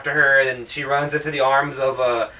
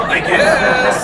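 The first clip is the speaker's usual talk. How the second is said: high, loud shrug of a reply; reluctant Jerry; 10 semitones higher, 6 dB louder than usual. Retold or imitated. Jerry reluctantly agreeing to the radio. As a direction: give in reluctantly. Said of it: "Jerry's like".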